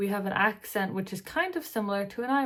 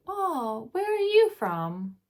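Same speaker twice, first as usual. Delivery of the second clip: high, rising, drawn-out question; curious asker; 7 semitones higher, 6 dB louder, slower than usual. Retold or imitated. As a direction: mimic a curious local asking, slowly and loudly. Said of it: "I always get".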